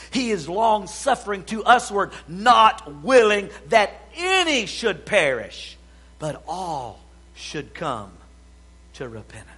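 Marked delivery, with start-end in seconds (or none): none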